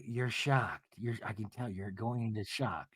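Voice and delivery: low, hushed deadpan impression; subdued mock observation; Meredith imitation. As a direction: drop it low and very soft. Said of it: "And Mary's like".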